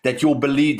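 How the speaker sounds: ordinary talk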